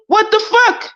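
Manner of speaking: high, incredulous "what the fuck"; shocked disbelief. Real, not acted. Reacting to his claim, high and disbelieving. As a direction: jump up in pure disbelief.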